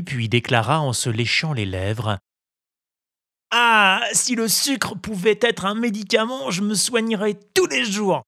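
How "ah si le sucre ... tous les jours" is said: put-on high, eager puppet boy; eager child. A narrator's reading. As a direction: ask greedily for the sugar. Pinocchio licks lips. (voix d'enfant impatient)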